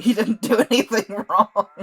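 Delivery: cracking up while trying to say it; laughing out loud mid-sentence. Real, not acted. Laughing at his own joke.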